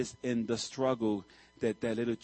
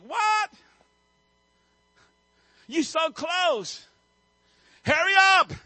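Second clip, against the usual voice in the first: mock disbelief then shouted push, much higher and louder; impatient urging; 8 dB louder, slower than usual. Viewer pushing the exhausted chick, mock impatient shout. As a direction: drag the words out, then yell at it to hurry.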